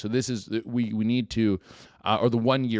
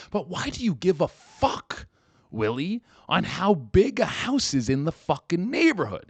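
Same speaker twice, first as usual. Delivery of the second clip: exasperated rant; 8 semitones higher, 3 dB louder than usual. Real, not acted. Ranting at the nosy neighbor.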